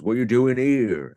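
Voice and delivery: hammed-up guard demanding what you're doing; station guard impression. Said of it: "this is a very good impression".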